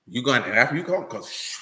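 ordinary talk

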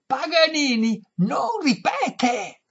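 high, slow, very loud yell. A narrator's reading. Violinist cupping hands at lady's ear, slow high yell. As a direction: bellow it slowly into a deaf ear. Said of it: "le urlò quasi all'orecchio".

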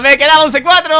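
loud, high laughing boast; triumphant shout through laughter; gleeful gloating. Real, not acted. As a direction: crow over the prank while laughing. Gloats after blowing friend up.

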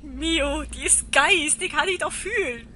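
loud and high, on edge; alarmed. Real, not acted. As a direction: cry out in alarm at the ghost. Shocked at the ghost.

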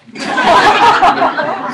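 high, loud mocking laugh; a loud, high laugh that mocks them. Laughs before addressing parents.

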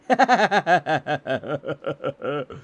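long, rapid staccato cackle; crazed laugh. Acted. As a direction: laugh on and on as if cracking up. Character insists he is not crazy.

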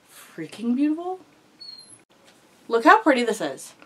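high and loud with delight; thrilled squeal. Real, not acted. Unboxing the wanted palette.

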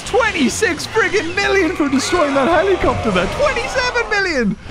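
high, thrilled shout; excited high cheer. Real, not acted. Celebrating high score.

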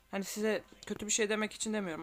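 usual speaking voice

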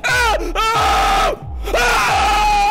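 sudden startled screaming; terrified screams. Real, not acted. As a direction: scream in real fright. Jump scare in horror game.

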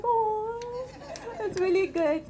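high, pleased, self-admiring gush; delighted to herself. Retold or imitated. Speaker praising her own voice as she talks, delighted. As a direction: gush over your own voice. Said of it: "I'm like".

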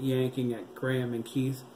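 ordinary delivery, nothing marked

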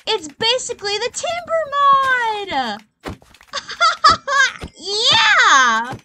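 excited shriek. Real, not acted. Gamer gets the axe, laughing shriek. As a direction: squeal with delight at the loot.

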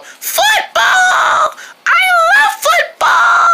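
high, shouted burst of joy; excited cheer. Acted. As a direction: yell it like you can't wait to play. Excited kid cheering about football.

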